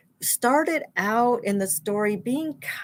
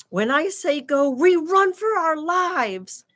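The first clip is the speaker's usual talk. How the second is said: tense instruction; 6 semitones higher than usual. Acted. Jack instructing Annie before running.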